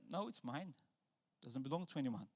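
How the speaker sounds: soft, hushed firm claim; quiet claim. Retold or imitated. Jesus softly claiming the lost sheep, hushed. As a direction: say it softly, keeping what's yours. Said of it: "He said".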